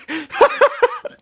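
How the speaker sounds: high burst of laughter; real laugh. Real, not acted. Reader reacts to an unexpected character, laughing. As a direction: crack up in disbelief. Reader cracks up at plot twist.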